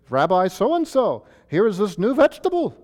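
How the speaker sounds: put-on high-pitched New York accent, playful questioner. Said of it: "give them a New York accent".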